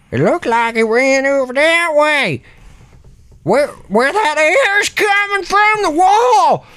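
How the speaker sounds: put-on high, shouted old-timer voice; excitable old Ernie. Ernie discovering air coming from the wall, astonished. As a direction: exclaim with mounting astonishment, drawing out the end.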